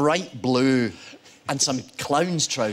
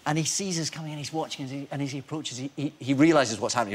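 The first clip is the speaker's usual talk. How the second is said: normal talking voice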